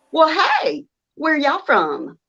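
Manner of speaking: exaggerated Southern accent, sugary greeting. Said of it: "I have to accentuate my accent".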